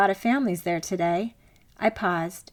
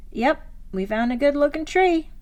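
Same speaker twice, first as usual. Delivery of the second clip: a put-on Southern drawl, pitched higher and louder, playful; husband hams up a Southern accent to make her laugh; fake Southern drawl; 6 semitones higher, 4 dB louder than usual. Husband jokes to his wife in mock Southern drawl. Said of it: "with a fake Southern drawl that made me laugh".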